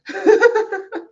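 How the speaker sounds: a sudden high laugh; high burst of laughter. Real, not acted. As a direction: laugh at yourself. Laughs at own frustration.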